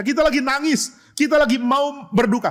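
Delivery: high, aggrieved complaint; indignant protest. Acted. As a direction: object as mourners being thrown out. Acting mourners protesting expulsion. (protes kesal bernada tinggi)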